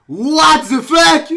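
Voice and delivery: high, very loud, drawn-out yell; explode, stretching out each word; shocked yell. Real, not acted. Reacting to too-fast solves, shocked yell. Repeats it as a scream.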